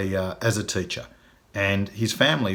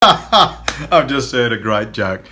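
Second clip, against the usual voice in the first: chuckling through the words, slow and louder; laughing; 2 semitones higher, 7 dB louder, much slower than usual. Real, not acted. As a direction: laugh as you announce the joke. About to tell the joke.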